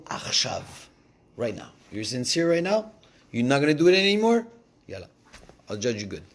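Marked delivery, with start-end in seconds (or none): 1.9-4.4 God's questioning. Acted. God questioning the sinner.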